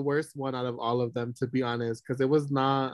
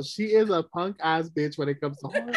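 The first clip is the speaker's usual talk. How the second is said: usual speaking voice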